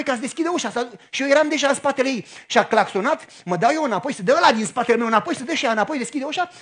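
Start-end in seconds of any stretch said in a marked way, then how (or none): none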